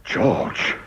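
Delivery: a breathless whispered George; shocked whisper. Acted. Lord appalled at his partner's fraud, whispered. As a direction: gasp the name in disbelief.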